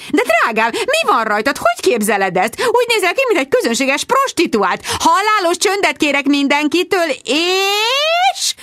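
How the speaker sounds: magas hangú, színpadias rikoltozás; rikácsold magasan, felháborodott anyaként; rikoltozó anya. A narrator's reading. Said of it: "rikoltotta a videokamerát emelgető". (magas hangon rikoltozó anyuka)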